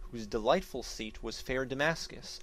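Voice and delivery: usual speaking voice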